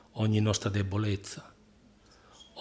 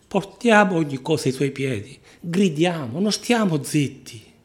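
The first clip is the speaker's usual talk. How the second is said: tag; 5 semitones higher, 6 dB louder than usual. Real, not acted. Preacher's rousing call to cry out.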